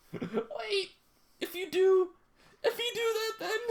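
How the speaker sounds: high panicked squeak; mock panic. Character panics in the stall, high squeak. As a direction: plead in a fluster.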